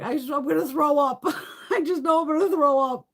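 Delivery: high, loud panicky pleading; panicky kid. Retold or imitated. Scared kid begging the father, high and loud. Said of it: "I'm like".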